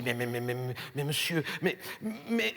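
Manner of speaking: low, hushed stammer; quiet, low sputtering; stammered objection. Acted. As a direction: stumble softly over a timid protest. Sceptical director stammers objection.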